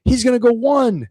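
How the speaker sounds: lightly voiced smug brother; older brother. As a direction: tease like a smug big brother. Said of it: "he goes".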